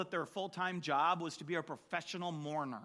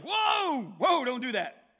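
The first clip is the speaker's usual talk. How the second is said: loud, high panicked protest; hammed-up alarm; mock alarmed crowd; 5 semitones higher, 10 dB louder, slower than usual. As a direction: cry out to stop him. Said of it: "they're like".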